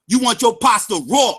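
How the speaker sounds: ordinary talk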